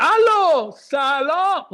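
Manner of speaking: very loud, high drawn-out hello; yelling into phone. Acted. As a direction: bellow a greeting down a bad line. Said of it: "why are you yelling into the phone?".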